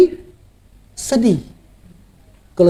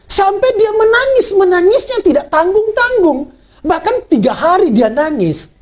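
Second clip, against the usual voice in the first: fervent preaching; 9 semitones higher than usual. Acted. Preaching on lost hope.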